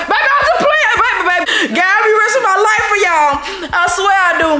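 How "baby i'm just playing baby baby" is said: real playful begging, pitched way up; high-pitched pleading. Real, not acted. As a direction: plead fast in a high, squeaky voice. Prankster placating an angry partner.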